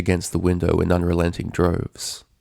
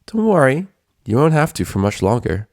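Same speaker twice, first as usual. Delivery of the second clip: higher, louder mock-soothing menace; gleeful sadistic Twilight; 6 semitones higher, 5 dB louder than usual. A narrator's reading. Twilight taunting Rainbow as she draws the knife. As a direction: sweetly promise the end. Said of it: "erupted into a fit of laughter".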